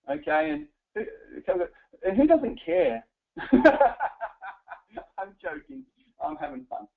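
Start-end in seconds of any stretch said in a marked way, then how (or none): 3.4-4.7 loud, high burst of laughter; real amused laugh, louder and higher. Real, not acted. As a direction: laugh out loud at your own joke. Laughing at own joke.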